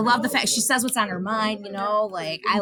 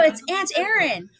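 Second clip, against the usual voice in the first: retold call out; 8 semitones higher than usual. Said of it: "I go".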